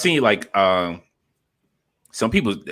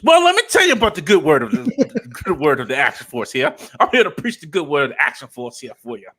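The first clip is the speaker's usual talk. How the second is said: mock preacher; 7 semitones higher, 7 dB louder than usual. Mock preacher spreading Action Force.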